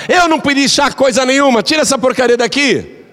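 put-on high, rude old woman snapping; grumpy mother-in-law. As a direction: brush her off like a cranky old lady. Grumpy mother-in-law refuses tea. (sogra ranzinza, voz aguda e grossa)

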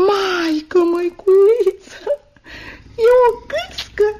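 slow, drawn-out wonder rising high; amazed discovery. Acted. Girl finding a goose in the bag, amazed. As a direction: gasp out your astonishment, stretching the words.

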